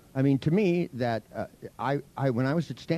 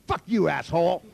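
higher-pitched, punchy mock insult; retold defiant insult; 4 semitones higher, 4 dB louder than usual. Showing a patient how to insult the lab-coated man, defiant. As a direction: hurl the insult, a touch theatrically. Demonstrating a rude outburst at doctor.

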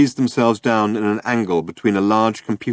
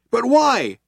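high, raised question; demanding; 4 dB louder than usual. A narrator's reading. Trillian demanding a reason from the cops, raised. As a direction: demand an answer. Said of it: "demanded Trillian".

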